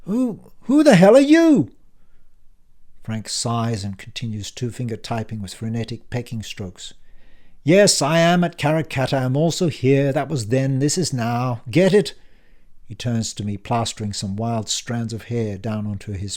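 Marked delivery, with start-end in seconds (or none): 0.0-1.7 much higher, loud demanding yell; frightened shout. Acted. Dan bursts out. 7.6-12.1 put-on louder, higher, impatient old man; brusque uncle Frank. Frank explains.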